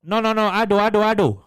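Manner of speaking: high, loud repeated insistence; insistent mock customer. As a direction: imitate a customer loudly refusing help. Customer insists, language barrier.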